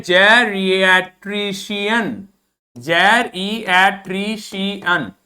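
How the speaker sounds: जेरिएट्रिशियन drawn out very slowly; slow model pronunciation. Shown as a demonstration. Teacher slowly models the word for students. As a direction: stretch each syllable of जेरिएट्रिशियन. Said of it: "ये वाला sound है".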